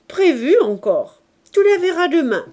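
loud, high burst of assurance; exuberant grandfather. A narrator's reading. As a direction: promise it with a delighted outburst. Grandfather exclaims in answer to Marius.

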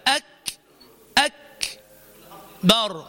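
breathy puff after the K of أكبر; aspirated K. Shown as a demonstration. Teacher showing students the kaf's hams, aspirated K. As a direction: hold "أك" and release air before "بر". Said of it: "فأنطق الهمس هكذا".

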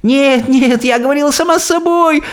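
very high, desperate wail; nearly sobbing. A narrator's reading. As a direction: wail the excuse in panic. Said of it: "почти рыдала несчастная".

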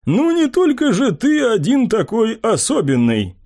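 wry, high mocking reply; smirking necromancer. A narrator's reading. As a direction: tease him with a smirk.

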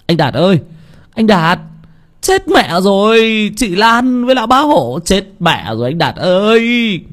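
put-on high alarmed boy shouting news; frantic calling. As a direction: call him out in alarm. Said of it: "gọi láo nháo".

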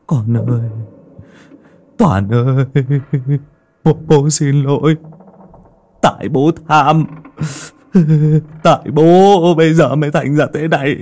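a father begging his vanished son for forgiveness; grieving father's plea. Acted. Old father calling to his lost son, grief-stricken and pleading.

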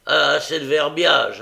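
loud impatient interruption; irritated loud snap. A narrator's reading. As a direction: cut him off sharply. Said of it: "interrompit le voleur avec impatience".

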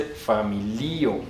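familio slowly, fa-mi-LI-o; stress on second-last syllable. Shown as a demonstration. Teacher corrects the class, familio drawn out as fa-mi-LI-o. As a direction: stretch the LI of familio. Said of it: "se dice familio".

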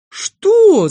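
very high, loud yelp; piercingly pitched indignant outburst; shocked shout. Acted. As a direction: cry out in outraged disbelief. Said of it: "закричал Пончик".

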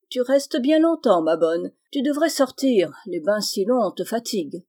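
usual speaking voice

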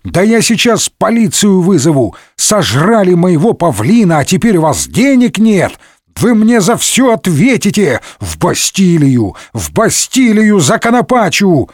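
high-pitched ranting innkeeper; outraged shouting. A narrator's reading. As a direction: threaten them in a high, frantic rant. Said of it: "закричал ошалевший хозяин". (возмущённый крик ошалевшего хозяина высоким голосом)

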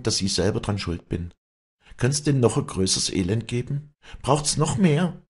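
high, anguished pleading; Wally confessing her despair to the priest. A narrator's reading. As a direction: pour out her guilt.

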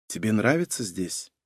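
soft, hushed question; quiet. Acted. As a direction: ask it gently and quietly. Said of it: "тихо спросила Лена".

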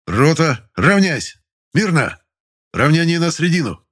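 barked parade commands, high and loud; shouting drill sergeant. A narrator's reading. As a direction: bellow the drill commands across the square.

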